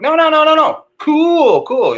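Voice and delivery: put-on playfully correcting the kid; dad correcting his son's slang in a high voice; mock insistent. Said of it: "And I'm like".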